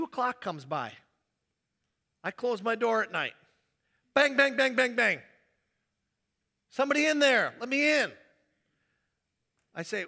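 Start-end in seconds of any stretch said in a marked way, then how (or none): 4.1-5.2 loud, high "bang, bang, bang". Acted. Late guest pounding on the pastor's door, loud. 6.7-8.1 loud, high call to be let in; demanding guest. Acted. Wedding guest at two a.m. demanding entry, loud.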